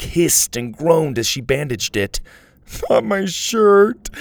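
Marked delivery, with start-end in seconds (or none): 2.9-4.1 high, slow wail; hurt boy crying out over his shirt. A narrator's reading. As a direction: mourn the shirt dramatically. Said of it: "he cried".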